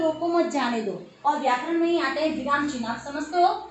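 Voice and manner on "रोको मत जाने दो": "रोको मत जाने दो" read as one unbroken phrase; run together. Shown as a demonstration. Teacher reading the sentence without any comma, "रोको मत जाने दो" run together. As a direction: say "रोको मत जाने दो" straight through with no pause. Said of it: "अगर इसको साथ में पढ़े".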